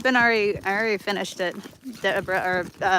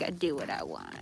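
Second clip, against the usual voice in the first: low, soft defiant mock voice; lower voiced stubborn dog; 11 semitones lower, 11 dB quieter than usual. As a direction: voice the dog's stubborn reply. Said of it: "He's like".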